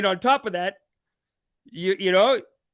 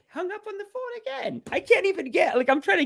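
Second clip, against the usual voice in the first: exasperated squeal. Real, not acted. Host realises the scammer hung up again, high squeal of disbelief. Scammer hangs up a second time.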